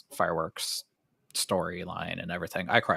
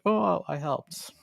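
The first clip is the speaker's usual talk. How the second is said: slow, higher, drawn-out mock pride; mock proud; 10 semitones higher, much slower than usual. Planner mocks her own pride in surprise videos, slow and high. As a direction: savor it like a little boast. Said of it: "I'm like".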